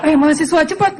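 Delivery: high-pitched hurrying call; mock urgent call, voice jumping up; imitated calling out. Bus driver teases students to hurry, mock calling out.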